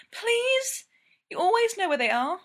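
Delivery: put-on high, pleading young woman; begging. Ella begging.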